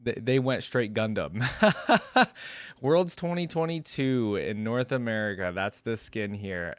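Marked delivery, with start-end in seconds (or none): 1.5-2.8 high, loud delighted laugh; real amused laugh; burst of laughter. Real, not acted. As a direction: crack up at the reveal. Laughs at the Gundam skin.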